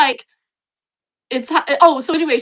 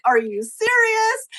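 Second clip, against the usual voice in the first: high, slow, incredulous are you serious; exasperated disbelief; 10 semitones higher, slower than usual. Retold or imitated. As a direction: stretch it out in fed-up disbelief at yourself. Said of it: "I'm just like".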